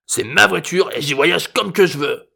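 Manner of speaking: slow, high, hoarse exclamation; indignant old man. A narrator's reading. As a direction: insist crankily like a defiant grandpa. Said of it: "s'exclama l'ancêtre".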